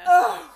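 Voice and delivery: sudden high, loud "ugh"; exasperated loud groan; loud exclaimed groan. Real, not acted. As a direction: burst out with a groan. Reacts to co-host's idea.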